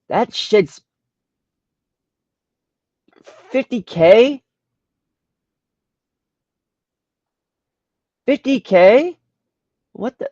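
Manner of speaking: high, loud and stunned; can't believe the number; shocked disbelief. Real, not acted.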